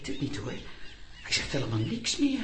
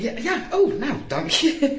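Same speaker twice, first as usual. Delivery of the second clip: lachend; 8 semitones higher, 7 dB louder than usual. Acted. Lachend bedankt.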